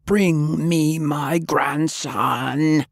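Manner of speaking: high, very slow bellowing shout; drawn-out, high blustering roar; shouted at the top of his lungs. Acted. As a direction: bellow it across the hall. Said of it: "shouted at the top of his considerable lungs".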